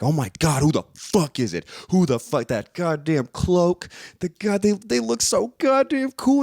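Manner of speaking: rising into high, hyped fanboy gushing. Retold or imitated. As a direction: build from curious to thrilled fan. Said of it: "I was like".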